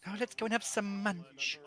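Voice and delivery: high, drawn-out mock Essex; Essex accent. Mocking an accent he hates, exaggerated Essex accent. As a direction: put on a slow, high Essex voice. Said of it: "God, I hate the Essex accent".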